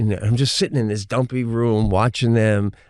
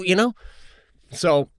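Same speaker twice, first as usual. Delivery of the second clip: usual speaking voice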